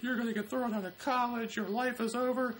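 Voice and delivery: very high-pitched imitation of a weeping mother; mock tearful mom. Mom to son over the arrest letter, mock tearful wail. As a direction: wail it as an exaggerated crying mom. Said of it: "She's, like, in tears".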